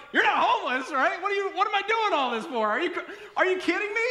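high, loud, rattled questions; flustered disbelief. Retold or imitated. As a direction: blurt it out high, mortified and incredulous. Flustered reaction to embarrassing mistake.

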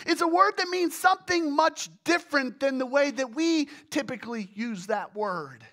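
usual speaking voice

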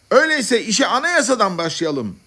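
high-pitched public call; assembly leader. Acted. Head madman to the congress, calling out his proposal.

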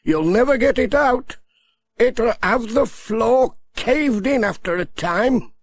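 high villager voice in a story. Acted.

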